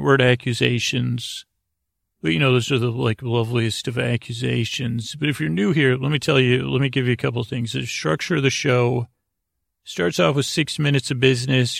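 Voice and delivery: ordinary talk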